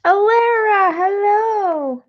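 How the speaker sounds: bright greeting. Real, not acted. Greeting a viewer by name.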